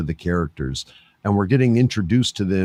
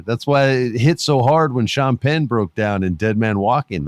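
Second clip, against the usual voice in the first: ordinary delivery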